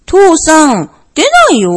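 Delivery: tugging at dad, nothing's there; disappointed bear cub. A narrator's reading. Bear cub tells father no star appeared, disappointed.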